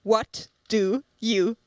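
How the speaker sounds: very slow, with a pause after each word; imitated exasperated, over-deliberate question; slow, as to someone uncomprehending. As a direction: spell out "What do you want" word by word. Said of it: "as if I had not understood".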